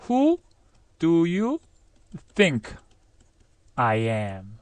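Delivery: WHO, DO YOU, THINK, I AM with pauses between; careful step-by-step build-up; slow model sentence. Shown as a demonstration.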